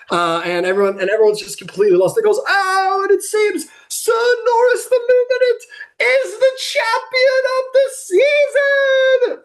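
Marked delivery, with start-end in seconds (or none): 2.5-9.4 high, excited arena announcer call; stretched-out high championship call; tournament announcer. Acted. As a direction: proclaim the winner to the stands.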